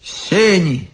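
high, falling doleful call; mournful announcement. A narrator's reading. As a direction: announce it sadly, resigned. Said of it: "и печально возгласил". (печальный возглас)

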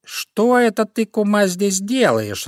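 high, slow, stretched cat voice; cat's sudden question. A narrator's reading. Cat surprises the fox at the trap, inquisitive. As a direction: ask it high and drawn out. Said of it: "раздался вдруг чей-то голос".

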